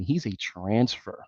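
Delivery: host muttering a dry fact; quiet aside. Real, not acted. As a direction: drop it low and slow. Repeats the line after laughing.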